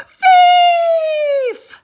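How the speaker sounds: full-throated high scream; yelling thief. Acted. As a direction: shriek it like a cry for help. Someone yells from the hallway.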